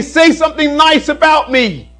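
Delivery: loud, high, showy demand; mock plea for praise. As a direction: cry out for a compliment, hammed-up. Voicing the decorations' plea for praise.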